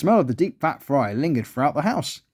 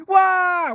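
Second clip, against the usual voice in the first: high, drawn-out storybook wail; high-pitched panicked "wah"; 4 dB louder than usual. A narrator's reading. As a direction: cry out in comic fright. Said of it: "wailed Joyce".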